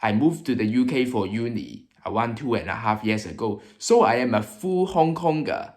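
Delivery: English with a Hong Kong accent. Shown as a demonstration. YouTuber introducing UK culture shocks, Hong Kong accent. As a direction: speak English like a Hong Konger. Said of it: "I will be speaking with a Hong Kong accent".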